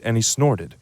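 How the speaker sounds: plain reading voice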